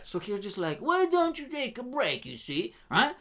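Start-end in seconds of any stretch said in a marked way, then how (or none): 0.8-2.7 high, slow put-on British accent; British man. British guy telling the hero to rest, mock British accent. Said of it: "British man".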